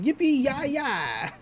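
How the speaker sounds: high mock cowboy whoop. Cowboy having a real great time, mock whoop. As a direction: start high and drop, imitating a cowboy's cheer. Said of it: "He's laughing. He's like".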